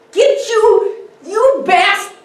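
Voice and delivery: high, loud, cut-off yell; lunging shout. Acted. Drunk lunging at Kitty, high cut-off yell. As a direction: roar as you lunge at her.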